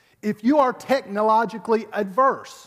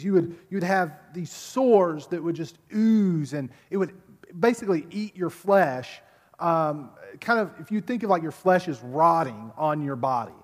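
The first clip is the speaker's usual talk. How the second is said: ordinary talk, nothing marked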